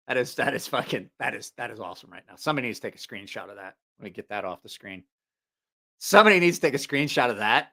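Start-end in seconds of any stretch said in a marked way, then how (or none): 2.4-5.0 low, soft and quick; muttered aside. Real, not acted. First take, said in passing. 6.0-7.7 raised, clearly projected request; calling to viewers. Real, not acted. Repeats it out to viewers.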